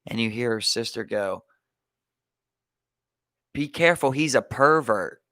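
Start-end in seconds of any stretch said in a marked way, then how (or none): 3.5-5.2 louder, higher imitation of a little girl's warning; retold warning. Sister warns the other girl about stepdad, retold loud warning.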